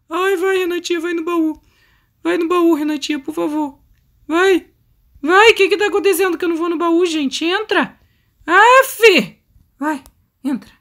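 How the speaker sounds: high-pitched, frantic pleading; frantic urging. Real, not acted. Player urging Renatinha into the trunk, frantic. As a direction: beg your character to hurry, rising in panic.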